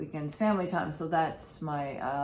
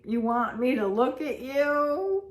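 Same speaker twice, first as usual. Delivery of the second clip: high sassy pet voice, much higher than her own; voicing the cat; 9 semitones higher, 4 dB louder than usual. Owner voicing her cat talking back to mommy, in a high sassy voice. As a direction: talk back like a cat giving mommy the side eye. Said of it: "She was giving me the side eye, like".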